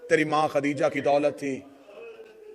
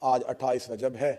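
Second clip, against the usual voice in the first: hushed prayer; 4 semitones lower than usual. Real, not acted. Soft prayer and solemn lament.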